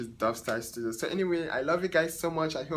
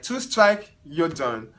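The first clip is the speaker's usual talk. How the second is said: firm cutoff; 2 semitones higher, 7 dB louder, slower than usual. Retold or imitated. Stylist retelling ending it with the client, louder. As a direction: lay down the final word.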